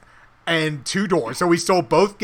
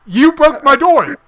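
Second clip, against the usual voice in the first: slow, loud and higher, hammed-up outrage; outraged homeowner; 9 semitones higher, 6 dB louder than usual. Homeowner yelling at the players, outraged. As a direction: play the wronged homeowner, dragging out the shock.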